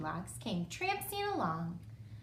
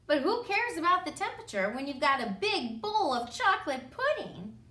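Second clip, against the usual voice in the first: ordinary delivery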